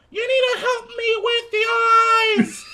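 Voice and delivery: very high, shouted child impression; hammed-up kid hollering for help; loud annoying kid. Kid asking Dad's help carving, mock high yell.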